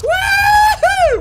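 high, loud "woo-hoo" whoop; gleeful shouted whoop; whooping cheer. Real, not acted. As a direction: let out a big high-pitched whoop. Cheering while dropping into game.

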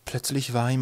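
usual speaking voice

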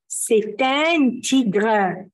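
very slow, each word apart; slow model answer. Shown as a demonstration. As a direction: lay it out slowly for repeating. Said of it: "هنقرا تاني الكلام". (ببطء شديد للتكرار)

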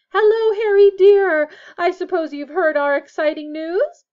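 high, loud, gushing welcome; excited motherly greeting. A narrator's reading. Missus Weasley greets Harry with news, excited. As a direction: sing out the greeting with delight.

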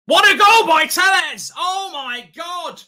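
huge joyful shout; goal yell. Real, not acted. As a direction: roar it out for the goal. Goal scored for his team.

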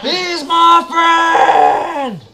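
slow, loud, high pleading yell; desperate yell. Real, not acted. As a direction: yell to protect a friend. Friend zombie being attacked.

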